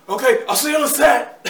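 high, loud call; shouted goodbye. Acted. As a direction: shout back on the way out. Actor calling while leaving.

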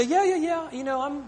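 high, brushing-off voice; mock casual brush-off; dismissive shrug. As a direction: wave it away casually. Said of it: "some of us say".

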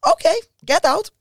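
high-pitched Norwegian dismissal; put-on Norwegian accent. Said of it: "do a Norwegian accent".

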